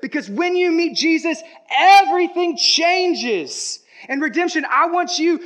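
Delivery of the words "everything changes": much louder, higher, drawn out "everything changes"; slow emphatic climax. Acted. Climactic sermon line.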